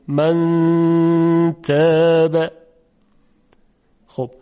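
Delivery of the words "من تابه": من تابه drawn out very slowly, with the نون hidden in a long hum; slow chanted من تابه; hidden nasal n. Shown as a demonstration. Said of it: "پس حالت بینابین".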